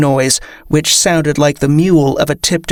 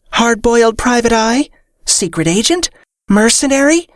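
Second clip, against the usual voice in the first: high, bright rapid-fire guesses; eager guessing; 8 semitones higher than usual. Acted. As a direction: chirp out each wild guess. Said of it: "The fairy chimed in with another flurry of wild guesses".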